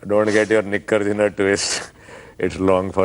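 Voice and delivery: Aussie slang in a thick Haryanvi accent. Shown as a demonstration. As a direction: talk Aussie but sound fully Haryanvi. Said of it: "slang in a Haryanvi accent".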